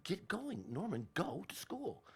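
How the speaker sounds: acted-out parental order; retold insistent mom. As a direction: imitate a mother shooing her child off to school. Mom voiced ordering child to go.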